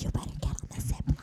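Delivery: fast, hushed push; urgent whisper. Retold or imitated. Friend urging her onto the zip line in a quick whisper. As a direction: whisper it like a dare.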